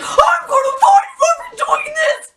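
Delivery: high, loud mock-furious threat; hammed-up angry shriek; screaming dad. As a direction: yell like an enraged dad. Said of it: "He was screaming".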